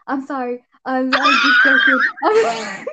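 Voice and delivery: high, loud, laughing fake apology; mock apology. Retelling an influencer's cowardly apology, high and laughing. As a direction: squeal out a backpedaling sorry, laughing. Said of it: "And Bryce was like".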